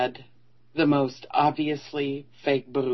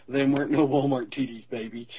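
slow Ozark drawl; Ozark accent; 2 semitones lower than usual. Retold or imitated. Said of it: "in his Ozark drawl says".

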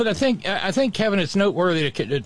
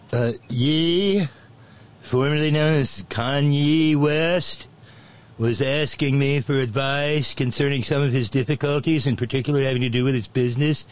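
pompous voice. Pretend. Bragging in mock persona.

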